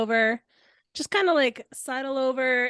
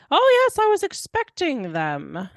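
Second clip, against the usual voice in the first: high, loud put-on doctor voice, dropping away; Doctor Egg guy; 7 semitones higher, 5 dB louder than usual. Spell fakes the doctor's voice, high and hammy. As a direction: open big and high, then fall. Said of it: "create the voice of the".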